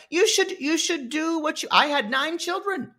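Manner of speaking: high-pitched scolding mother-in-law impression; Moneybags. Mother-in-law lecturing the wife about duty, high mocking voice. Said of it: "Moneybags is like".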